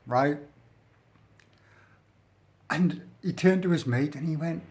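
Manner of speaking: usual speaking voice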